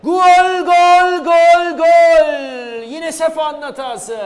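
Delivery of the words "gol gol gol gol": repeated roaring goal call; screaming football commentator. Real, not acted. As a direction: shout every goal as loud as you can. (gol diye haykıran spiker)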